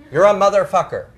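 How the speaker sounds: big, punched-out demonstration curse; mock cursing. Shown as a demonstration. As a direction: hurl an example insult. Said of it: "You're cursing someone".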